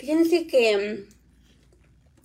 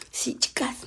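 whisper; 2 semitones lower, 7 dB quieter than usual. Real, not acted. Whispering aside near the baby.

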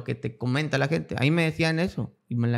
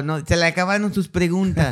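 laughing; 4 semitones higher than usual. Real, not acted. Laughing at his own story.

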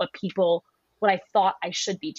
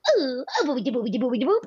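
silly mom voice; 4 semitones higher, faster than usual. Retold or imitated. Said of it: "always talks like that".

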